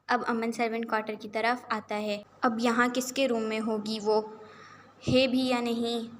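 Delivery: ordinary delivery, nothing marked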